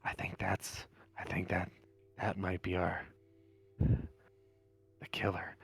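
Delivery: low, hushed hermit voice; nervous, secretive hermit. Acted. Hermit tells outsider of the well, low and hushed. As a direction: confide dark secrets quietly.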